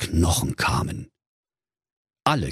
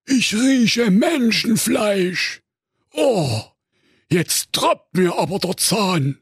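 high, blustering cartoon ogre; a greedy ogre barking orders; 13 semitones higher than usual. Ogre Karl-Heinz talks to wife.

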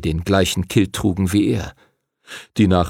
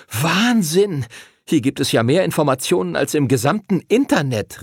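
pitched-up amazed exclamation; excited; 6 semitones higher than usual. A narrator's reading. Arthur marvels at the huge library, excited. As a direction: marvel aloud, thrilled. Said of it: "rief Arthur aufgeregt".

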